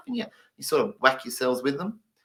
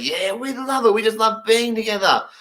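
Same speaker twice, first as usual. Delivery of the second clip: cheery Finnish guy; 8 semitones higher than usual. Pretend. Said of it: "He's like".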